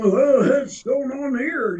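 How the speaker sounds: high, slow, dismayed oh; mock dismay of someone out of their depth; bewildered newcomer. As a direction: act out a lost newcomer gasping in confusion.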